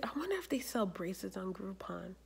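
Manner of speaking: whispered private thought; retold whisper. Vlogger retelling her secret thought about Groupon, soft whisper. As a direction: whisper the idea as if to yourself.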